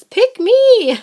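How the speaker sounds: high, loud squeaky fish voice; eager fish. Acted. The fish begging the angler, high and loud. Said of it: "And the fish says".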